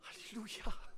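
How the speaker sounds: a hushed, breathy "Αλληλούια" under the breath; ψιθυριστό αλληλούια; whispered alleluia. Acted. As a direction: breathe the praise out in awe. Whispered praise after the story.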